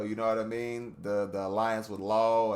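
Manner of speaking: ordinary delivery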